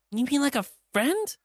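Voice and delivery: high, anxious question; nervous hope. Acted. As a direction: plead for the safe answer. TJ hopes for an out.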